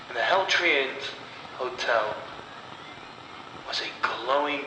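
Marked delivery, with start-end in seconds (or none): none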